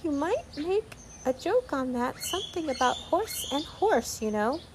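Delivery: high-pitched tiny creature voice; extremely small voice. A narrator's reading. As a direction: speak high and small, close to the ear. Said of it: "an extremely small voice".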